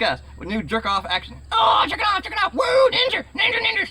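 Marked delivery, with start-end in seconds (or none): 1.5-3.9 high, loud squealing toy-play shout; way-up shrieking cheer while playing with a toy; hyped shouting. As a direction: yell it like a kid making his figure fight. Playing with ninja action figure.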